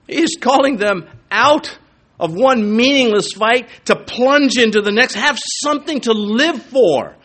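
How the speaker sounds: preacher calling the flock to war, loud and high; fiery preaching. Acted. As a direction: thunder it out from the pulpit. Preacher builds to full force.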